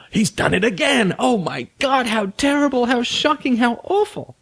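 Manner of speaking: high-pitched, hammed-up wail of outrage; mock shock. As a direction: wail like a scandalized spouse, badly overdone. Mock outrage of betrayed spouse.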